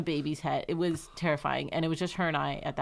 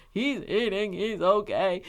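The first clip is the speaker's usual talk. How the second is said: teary relief; 4 semitones higher, 4 dB louder, slower than usual. Retold or imitated. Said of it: "I was just bawling".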